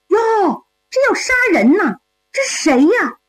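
put-on high-pitched startled young lady; surprised. A narrator's reading. Young lady comes upon an execution, surprised. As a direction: exclaim in surprise, pitched well up.

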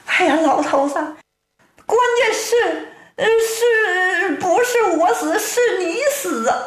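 high-pitched wailing wife voice; storyteller's acted weepy wife; tearful wife. A narrator's reading. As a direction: greet your husband in a high, fretful lament. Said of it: "正在屋中掉眼泪呢".

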